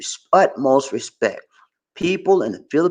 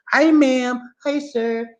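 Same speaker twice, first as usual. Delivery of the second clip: high, bright-voiced clerk greeting, much higher; imitating shop staff; 11 semitones higher, much slower than usual. Store clerk greeting a foreign customer, high and sunny. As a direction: chirp it like a cheerful store clerk. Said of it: "the way they do".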